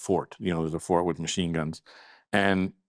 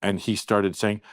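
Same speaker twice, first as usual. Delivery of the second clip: usual speaking voice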